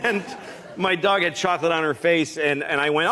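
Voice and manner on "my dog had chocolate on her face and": usual speaking voice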